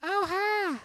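high Southern drawl on a bright greeting; southern twang. Retold or imitated. Tourist from Dallas greeting the cashier, southern twang. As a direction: sing it out like a Texan tourist at the counter. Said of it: "with just the southern twang".

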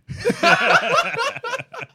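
high squealing laugh. Real, not acted. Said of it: "Oh, dude".

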